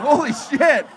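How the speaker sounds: comic reacting to the heckler's burn; shocked. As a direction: blurt it out in disbelief. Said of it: "I'm like".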